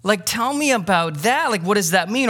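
a lightly voiced curious disciple; voicing a curious disciple. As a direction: play the disciple asking keenly. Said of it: "they don't go".

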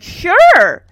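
high, very loud eager burst; hammed-up eager shout; over-enthusiastic. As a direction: jump at it far too keenly. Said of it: "Alan over-enthusiastically".

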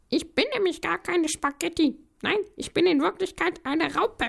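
high, squeaky little voice; squeaky noodle voice. Acted. Noodle insists to the policeman it is a caterpillar, high and squeaky. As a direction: fib sweetly in a tiny voice. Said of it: "Die Nudel sagt nämlich".

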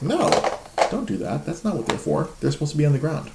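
high-pitched mock toddler telling dad off; scolding toddler. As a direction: play an indignant little kid, pitched well up. Voicing his toddler scolding dad.

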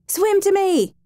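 very high ringing shout. A narrator's reading. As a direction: call out across the water. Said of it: "he shouted".

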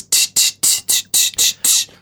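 tinny hi-hat clicks, whispered; tinny tch tch noise. Retold or imitated. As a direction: click your tongue like a tinny speaker. Tinny speaker sound imitation.

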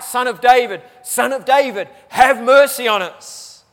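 desperate loud plea for mercy; crying out for mercy. Acted. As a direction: beg loudly like a blind man calling. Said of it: "they cry out".

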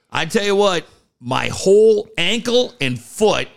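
ordinary talk

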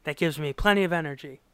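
rattled off fast, hopped up on caffeine; hyper fast talker. Retold or imitated. Caffeine-wired Flash rattling off his nap fast. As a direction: rush it like a caffeine-wired guy. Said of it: "He's like".

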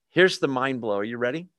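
usual speaking voice

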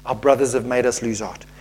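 low, subdued disbelief; dismayed repeat. Real, not acted. Preacher reacting to the verse, low dismayed aside. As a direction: let the voice sink in dismay.

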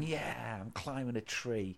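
mock quiet boast. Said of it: "I'm going".

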